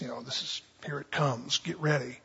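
hushed eager followers; hushed anticipation. Retold or imitated. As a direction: whisper it like a secret.

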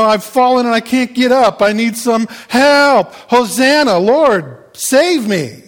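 hammed-up cry from someone helpless; desperate plea. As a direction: plead loudly, stretching the key words.

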